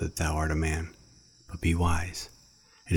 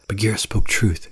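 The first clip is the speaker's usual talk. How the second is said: panting; 4 semitones higher, 5 dB louder than usual. A narrator's reading. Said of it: "he panted".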